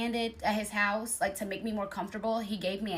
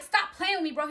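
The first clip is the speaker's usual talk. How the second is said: retold telling-off; 7 semitones higher than usual. Acted quarrel, telling him off.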